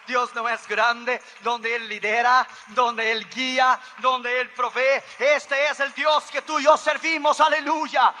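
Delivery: high, loud, shouted exhortation; full-force preaching. Real, not acted. Preacher urging the congregation to praise God, full-force preaching. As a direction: proclaim each phrase like a rallying cry, high and loud.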